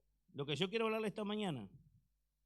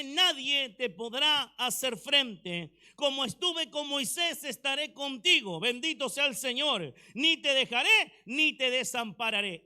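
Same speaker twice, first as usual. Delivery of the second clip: loud proclaiming; 3 semitones higher, 9 dB louder than usual. Real, not acted. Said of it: "Aquí vemos a Josué".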